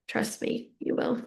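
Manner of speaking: soft unvoiced reassurance; whispering. Real, not acted. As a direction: whisper it close and gentle.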